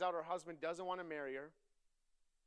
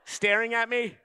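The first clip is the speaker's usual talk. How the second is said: loud, high, driving sermon voice; full-force preaching; 8 semitones higher, 21 dB louder than usual. Acted. Preacher building the story for his congregation, loud and high preaching. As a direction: push it out loud and high to the room.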